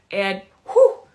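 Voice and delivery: loud whoo for the throw; mock whoosh. Coach acting out the teacher's throw, loud whoo. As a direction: sell the throw with a big whoo.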